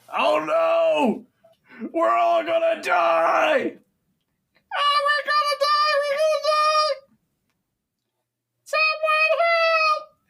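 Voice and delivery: high, shrill panicked screams; panicked screaming. Acted. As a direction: scream like terrified goblins about to die. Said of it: "you hear something like".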